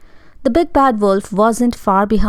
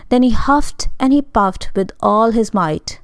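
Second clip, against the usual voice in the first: ordinary delivery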